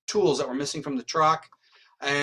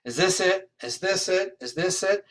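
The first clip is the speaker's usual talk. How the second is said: higher-pitched, insistent repeated question; same raised pitch on each asking; acted repeated questioning; 5 semitones higher than usual. Riding all over Maryland and Virginia, mock insistent questioning.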